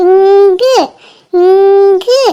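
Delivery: drawn-out coo with a rising end; long, playful "ingu" lifting at the end; baby cooing sound. Acted. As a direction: model a babble for the baby to copy. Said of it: "ஏதோ இங்க சொல்றா".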